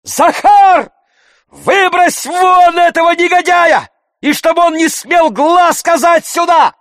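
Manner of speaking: loud, high-pitched furious order; shouting. A narrator's reading. Oblomov ordering Zakhar to throw him out, shouting. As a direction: yell the command at full voice. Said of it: "закричал Обломов".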